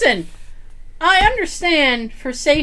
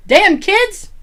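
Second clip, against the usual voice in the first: mock grumpy yell; 9 semitones higher, 6 dB louder than usual. Imitating old man yelling at kids.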